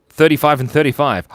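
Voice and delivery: high, loud burst at the tie; excited announcement. Real, not acted. Streamer announcing a neck-and-neck vote to viewers, excited. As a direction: call the tied score out big.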